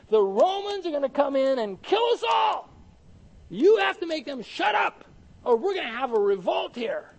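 high, loud Pharisee outburst; acted alarm, building to a strained high shout; agitated shouted rebuke. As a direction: shout it like a panicking official demanding silence. Acting out alarmed Pharisees rebuking Jesus.

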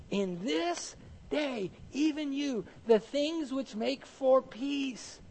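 high, very slow, drawn-out scripture plea; impassioned lament. Acted. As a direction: plead it word by word. Lamenting quotation of Jesus over Jerusalem.

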